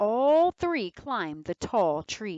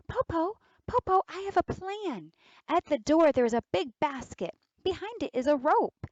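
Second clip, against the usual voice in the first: put-on clever girl's voice; 8 semitones higher, 4 dB louder than usual. Girl lays out the trap.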